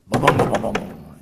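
loud booming crash noises; crash sound. Retold or imitated. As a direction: punch out each boom like an impact.

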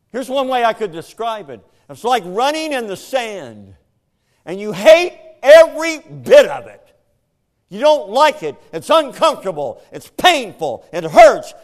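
loud, high sermon build; loud, driving preacher's delivery; full-force preaching. Acted. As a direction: hammer each line home from the pulpit.

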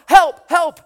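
high, loud mock cries for help; acted-out panicked shout; screaming for help. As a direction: yell it like a man being chased. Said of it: "he's screaming for help".

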